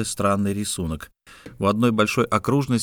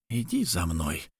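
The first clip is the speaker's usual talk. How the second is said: slow, hushed and strangled; strained whisper; 3 semitones lower, 7 dB quieter than usual. A narrator's reading. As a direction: press the words out in a hushed, choked voice. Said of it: "сдавленным голосом прошептал Харава".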